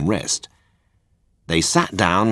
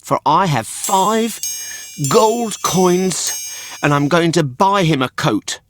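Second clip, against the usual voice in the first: proud; 11 semitones higher, slower than usual. A narrator's reading. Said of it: "said Pinocchio proudly".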